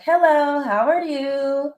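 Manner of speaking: high-pitched, drawn-out mock-polite greeting; put-on sweet courtesy, stretched out; polite and nice. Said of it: "I'm gonna be polite, I'm gonna be nice".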